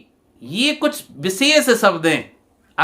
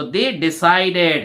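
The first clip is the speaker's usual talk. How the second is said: slow answer reading; 3 semitones lower, much slower than usual. Shown as a demonstration. Teacher gives the answer.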